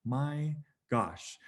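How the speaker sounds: slow, drawn-out oh my gosh in disbelief; exasperated disbelief. Retold or imitated. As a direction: let it sag out slowly, stunned. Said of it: "And I was like".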